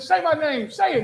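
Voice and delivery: high, pressing say-my-name; urgent demand. Retold or imitated. Psychic demanding the ghost's name, high urgent voice. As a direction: push him to prove himself. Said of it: "She said".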